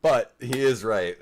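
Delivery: amused, smiling tone; smile in the voice. Real, not acted. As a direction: say it grinning. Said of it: "that would have been a smile in my voice".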